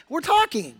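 high-pitched defensive comeback; mock indignant retort. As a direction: imitate an affronted family member. Family's retort to his complaint.

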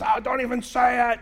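high, loud protest; acted disgust. Biker begging pastor to stop, high disgusted protest. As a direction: recoil and cut the teasing off.

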